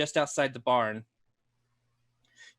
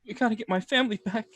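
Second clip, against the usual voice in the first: whimpering plea; 8 semitones higher than usual. Acted. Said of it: "he's just whimpering".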